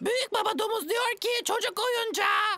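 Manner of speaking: parrot squawk. Acted. Parrot squawking the message back to grandpa pig. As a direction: squawk it out like a parrot repeating everything.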